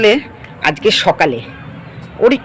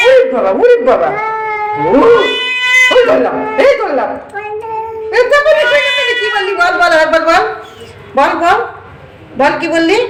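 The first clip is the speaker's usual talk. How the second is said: mock scolding; 13 semitones higher than usual. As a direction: dare the pet to say it again. Playful quarrel with the pet.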